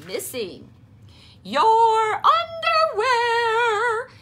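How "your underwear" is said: high, loud, slow sung-out tease; mother laughing at Froggy; laughing call. A narrator's reading. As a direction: laugh it out at him. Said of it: "his mother laughed".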